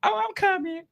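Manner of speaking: high, light imitation of her; lightly mock her sing-song; mock sweet voice. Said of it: "she came back around like".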